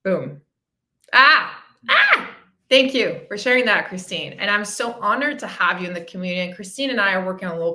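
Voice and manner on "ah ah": two loud cries of "ah", the second much higher; emotional outcry. Real, not acted. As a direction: let the emotion burst out in a high "ah!". Letting out emotion after testimonial.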